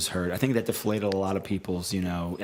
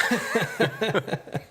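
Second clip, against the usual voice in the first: breaking into laughter; cracking up mid line; laughing; 11 semitones higher than usual. Real, not acted.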